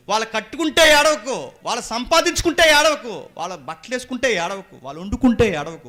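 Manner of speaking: loud, high preaching, easing down gradually; shouted admonition. Acted. Preacher warning church against envying neighbours, shouted admonition. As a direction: hammer out each repeated warning.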